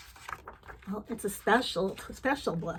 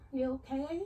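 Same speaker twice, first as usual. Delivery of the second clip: ordinary delivery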